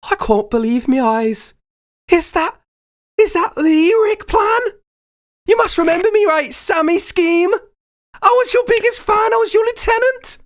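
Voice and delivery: very high, awestruck fan voice; Sammy. Acted. Superfan recognising old idol Rick Plan, high awestruck voice. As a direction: gush in a squeaky high put-on voice. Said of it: "he looks around at you and he goes".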